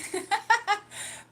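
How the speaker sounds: a burst of real high laughter; high loud laugh. Real, not acted. Streamer laughing at her own travel tip, loud high laugh.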